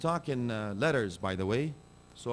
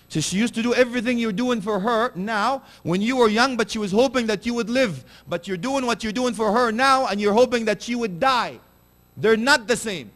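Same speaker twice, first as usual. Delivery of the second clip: high, loud impassioned sermon; fiery preaching; 10 semitones higher, 8 dB louder than usual. Acted. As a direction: drive it home with full force. Preaching at full force.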